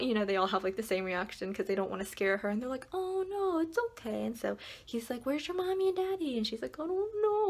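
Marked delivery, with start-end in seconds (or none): 2.9-6.4 put-on much higher, soothing voice for a scared child; calm the lost kid; reassuring grown-up talking to a toddler; gentle cop. 6.8-7.4 put-on much higher, tiny toddler voice; cute small child; little girl voice. Lost toddler answers.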